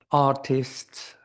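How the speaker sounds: ordinary talk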